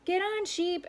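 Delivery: high group call; shouted. A narrator's reading. The animals call Sheep aboard, shouting. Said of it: "everyone shouted".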